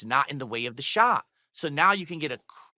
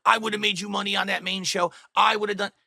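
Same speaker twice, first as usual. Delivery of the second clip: higher, held-up mimicry of a rival; mock his way of talking, pitched up; mocking imitation; 5 semitones higher than usual. Streamer mocking how the guy talks, high-pitched imitation. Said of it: "it's just hilarious how the guy talks".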